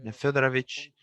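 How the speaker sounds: normal talking voice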